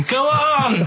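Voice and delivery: a mild mock yell of go on; retold cheer, half-hearted yell; mock shout. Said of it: "sort of shouting".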